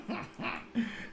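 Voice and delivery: breathy giggle. Real, not acted. Laughs at typo.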